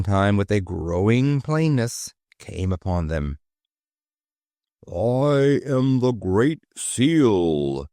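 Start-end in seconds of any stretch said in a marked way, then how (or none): 4.9-7.9 very slow, heavy, thick voice; thick furry voice. Acted. The Great Seal at the gate announcing itself, thick and slow. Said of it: "said a thick, furry voice".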